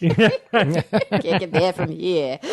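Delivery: put-on laughing, slow Kennedy-style New England accent; JFK. Teasing cohost's accent, laughing JFK imitation. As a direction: stretch it out like a mock presidential speech, laughing. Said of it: "You sound like J F K".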